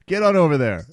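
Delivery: calling out. Real, not acted. Calling a friend over at event.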